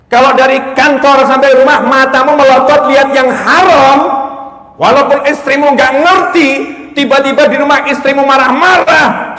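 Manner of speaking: loud, high-pitched sermon warning; fiery preacher rebuking the congregation; forceful preaching. Acted. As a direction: preach it hard at the husbands.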